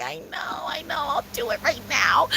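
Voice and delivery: acted-out whispered promise; whispered obedience. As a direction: whisper it back meekly, slowing at the end. Whispered answer to God.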